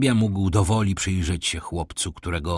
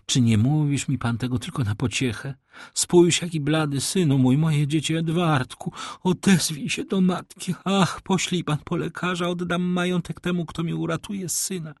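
high, pleading lady's voice; distraught mother; 7 semitones higher than usual. Acted. (zrozpaczona matka, błagalnie)

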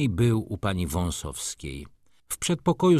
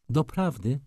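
higher, lively surprised really; animated exclamation; 7 semitones higher than usual. A narrator's reading. Pani Wąsowska perking up at the letter, animated. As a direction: perk up suddenly. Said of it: "zawołała ożywiona".

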